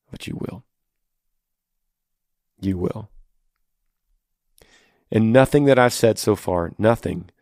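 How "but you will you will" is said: hushed, softer reassurance; gentle reassurance. Real, not acted. Host answering a suffering wife's doubt, gently reassuring. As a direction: let it drop low in volume, sincerely.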